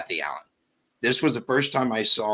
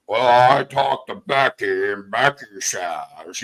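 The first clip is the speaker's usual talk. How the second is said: mocking Frank Meister; 5 dB louder, slower than usual. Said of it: "I'm not gonna come out here and say every five seconds".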